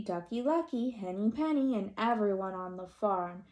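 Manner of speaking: ordinary delivery, nothing marked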